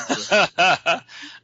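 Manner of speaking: hearty burst of laughter; real laugh. Real, not acted. Guest teased about playing Jesus, laughing. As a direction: crack up at the joke.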